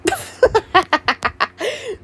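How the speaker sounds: high, rippling burst of laughter; long laugh. Real, not acted. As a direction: laugh helplessly, rising at the end.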